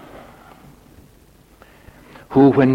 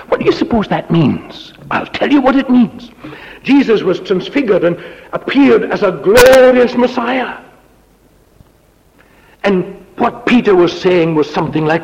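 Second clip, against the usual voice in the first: much higher and louder, ringing; full-force preaching; 9 semitones higher, 5 dB louder than usual. Acted. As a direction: lift it up to the glory.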